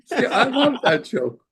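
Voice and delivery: real laugh through the words, pitched high; laughing delight. Real, not acted. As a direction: still giggling at his own punchline. Laughs after telling joke.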